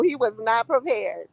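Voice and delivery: ordinary delivery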